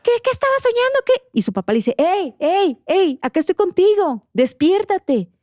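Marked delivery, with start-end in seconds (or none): 0.0-1.1 high, panicked waking-up questions; squeaky retold cry without real volume; mock startled cry. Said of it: "En eso grita de que". 2.0-5.3 lightly voiced dad waking his child; higher, insistent retold reassurance; urgent father. Father wakes daughter from nightmare.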